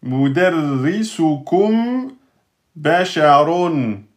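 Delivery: usual speaking voice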